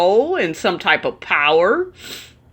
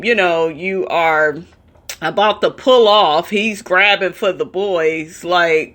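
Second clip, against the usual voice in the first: normal talking voice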